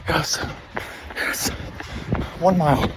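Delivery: breathless cheering; excited. Real, not acted. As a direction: celebrate the finish. Triumph after finishing run.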